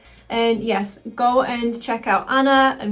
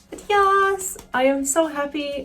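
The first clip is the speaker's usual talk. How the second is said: much higher gushing "yes"; a sudden high, happy "But yes"; excited burst; 6 semitones higher than usual. Real, not acted. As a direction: burst out happily. Excited wrap-up thanks.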